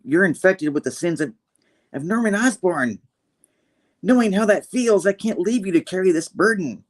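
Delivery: Red Goblin. Retold or imitated. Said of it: "she's like, you know, Red Goblin's, like, saying".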